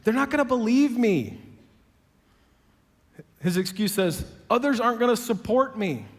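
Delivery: a hurt Moses listing who won't back him; Moses pleading. Retold or imitated. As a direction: plead every excuse, building to a cry. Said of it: "Moses says".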